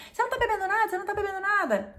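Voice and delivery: high, loud insistent questioning; mock nagging relatives. Relatives badgering her about not drinking, high and loud. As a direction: press her on why she isn't drinking. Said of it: "todo mundo bem incomodado".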